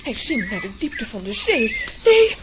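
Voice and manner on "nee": high, loud panicked cries for help; panicked cry. Acted. Aunt watching Lambiek sink, crying out high and loud in panic. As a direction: scream in horror as he sinks.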